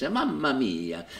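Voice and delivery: slow, warm-hearted Italian accent; a friendly Italian "Mamma mia". Shown as a demonstration. Showing the Gremio voice, a slow Italian accent. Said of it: "talk like this".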